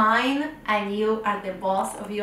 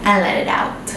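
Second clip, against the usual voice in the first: unhurried breathing cues, louder than the chatty intro; guided meditation; 3 dB louder than usual. Acted. Guide to children lying in bed, guided meditation voice. Leads breathing before bedtime story.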